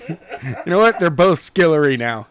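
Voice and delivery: giggling through the words; laughing off a stumble; amused, laughing aside; laughing at own slip. Real, not acted.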